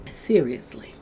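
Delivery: breathy whispered aside; whispered "Seriously". Real, not acted. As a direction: drop to a whisper for emphasis. Aside after homeless remark.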